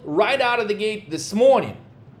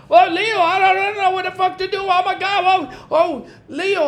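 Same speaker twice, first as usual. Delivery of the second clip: mock frantic trader, higher pitched and rushed; flustered client; 6 semitones higher than usual. Client who won't chase the trade, in a mock frantic whine. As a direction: play the hesitant client who keeps dithering. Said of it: "this motherfucker is like".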